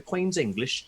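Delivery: exaggerated British accent; David Mitchell. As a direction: put on a mock Queen's English British accent. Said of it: "he doesn't quite talk like that".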